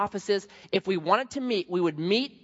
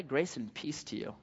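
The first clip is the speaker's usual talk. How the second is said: gracious greeting; 4 semitones lower, 11 dB quieter than usual. Retold or imitated. Imagined gracious reply.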